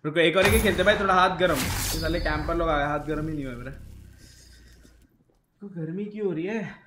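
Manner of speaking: ordinary delivery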